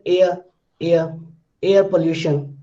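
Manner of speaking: "air" said as "eh-uh", no R; silent R in air. Shown as a demonstration. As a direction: drop the R in "air", also before "pollution". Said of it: "you can't hear the clear R sound".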